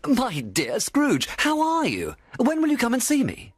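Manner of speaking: high-pitched, gushing acquaintance voice; effusive street greeting. A narrator's reading. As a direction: greet an old friend with exaggerated delight. Imagined friendly greeting in the street.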